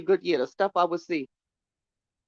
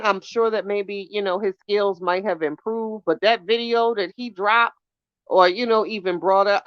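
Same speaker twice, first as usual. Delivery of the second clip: ordinary delivery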